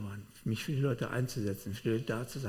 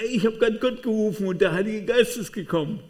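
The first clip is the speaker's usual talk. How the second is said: much higher and louder, bursting with excitement; excited report; 10 semitones higher, 9 dB louder than usual. Retold or imitated. Preacher telling his colleague he called God and the Spirit came, thrilled and loud. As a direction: blurt it out like you just can't hold it in.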